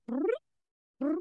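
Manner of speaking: rolling brr like a purring kitten; imitated cat purr. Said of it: "he goes like this".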